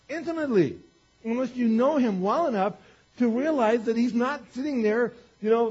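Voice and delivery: high, loud, insistent sermon delivery; impassioned preaching. Real, not acted. Preaches with rising force.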